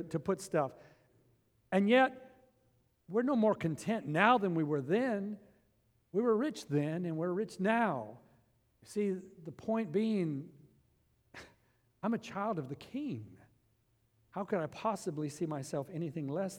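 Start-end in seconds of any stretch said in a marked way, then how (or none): none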